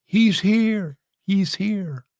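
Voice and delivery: high-pitched mock excited cry; excited calling out. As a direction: imitate an excited high call from indoors. Retold excited call from house.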